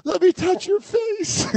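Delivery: high, slow, frail melodrama; hammed-up deathbed plea in a high voice; mock dying wife. Friend mimics the wife on her deathbed, high mock melodrama. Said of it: "Christine really is just like".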